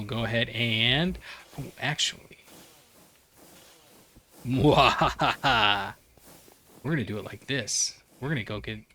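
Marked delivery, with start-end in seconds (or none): 4.5-5.9 slow theatrical villain cackle; a slow gloating mwahaha; evil laugh. Acted. Evil laugh after clever idea.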